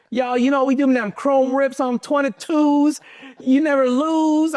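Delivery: high, slow southern gangster accent, hammed up. Young car dealer showing off rims in his old video, in a put-on southern gangster accent. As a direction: strut it like a wannabe southern gangster rapper. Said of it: "I used to put on this southern gangster accent".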